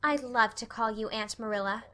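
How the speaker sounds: plain reading voice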